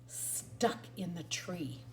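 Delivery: ordinary delivery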